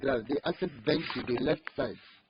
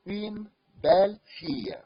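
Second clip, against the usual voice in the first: Une belle fille slow, louder and higher, rise on belle; emphatic model phrase; 5 semitones higher, 5 dB louder, slower than usual. Shown as a demonstration. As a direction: stress the model sentence. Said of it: "we are using this indefinite article".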